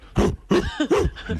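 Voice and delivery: high, sharp gasp of wonder; mock gasp. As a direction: gasp like discovering fire. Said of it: "he went".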